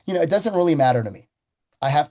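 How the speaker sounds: ordinary delivery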